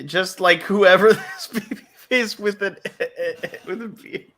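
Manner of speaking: laughing. Real, not acted. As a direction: break up laughing as you echo it. Amused echo of other's phrase.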